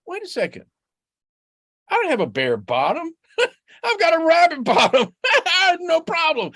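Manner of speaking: high, laughing rabbit voice; relieved Rabbit. Acted. Rabbit realizes he has fur, high and laughing.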